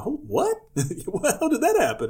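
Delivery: high, squeaky disbelief with a laugh; mock shock, high and slow. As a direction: gasp it out in amazement. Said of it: "I'm looking around going".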